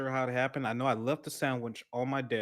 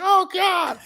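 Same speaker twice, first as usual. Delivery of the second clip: much higher, louder hammed-up yelp; mock panic; 12 dB louder than usual. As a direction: yelp it like you just saw the spider. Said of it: "I was like".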